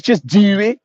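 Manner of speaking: Baltimore 'do', fronted and rising; loud teasing Baltimore-accent answer; mock Baltimore accent. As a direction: exaggerate the Baltimore vowel in 'do'. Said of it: "That Baltimore accent from a female".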